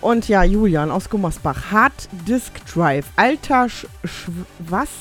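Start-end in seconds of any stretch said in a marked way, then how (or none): none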